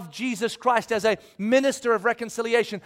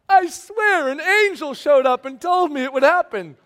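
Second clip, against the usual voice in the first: mock excuse; 5 semitones higher, 5 dB louder than usual. Mocking Mary's excuse.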